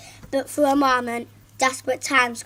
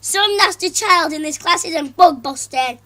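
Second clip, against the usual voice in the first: loud, higher accusing shout; angry parent; 4 semitones higher, 7 dB louder than usual. Acted. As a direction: accuse the whole class. Angry father continues.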